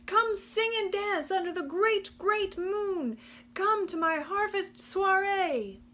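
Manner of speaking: shouting invitation. Acted. Possum shouting his invitation to the frogs. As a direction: call it out over the pond. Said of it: "Possum shouted".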